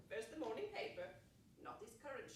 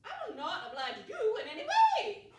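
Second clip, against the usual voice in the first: loud, higher, indignant refusal; defiant refusal; 11 semitones higher, 14 dB louder than usual. Acted. Old lady refusing the policeman, loud defiance.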